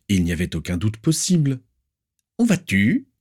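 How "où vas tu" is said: put-on very high, sharp, demanding old aunt; shrill aunt. Aunt demanding where the niece is, shrill and stern. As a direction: pinch every word with disapproval. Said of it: "lui demanda sa tante".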